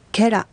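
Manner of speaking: ケラ with the accent on ケ; accent on first syllable. Shown as a demonstration. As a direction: model ケラ, stressing the first syllable. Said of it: "アクセントの位置は第一音節になります".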